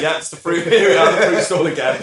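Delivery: laughing, shrugging mock seller; shruggy. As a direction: toss it off with a shrug, half laughing. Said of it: "like, shruggy".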